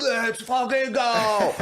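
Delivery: high, drawn-out fan roar; hyped fan shout. Acted. Stranger answering a fellow fan at the game, hyped shout. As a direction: stretch out a pumped-up cheer. Acting out fans answering the cheer.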